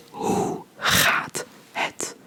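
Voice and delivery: "Hoe gaat het" drawn out slowly for repeating; teacher modelling a phrase to repeat; slow model phrase. Shown as a demonstration. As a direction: say it slowly so they can copy. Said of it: "Repeat after me".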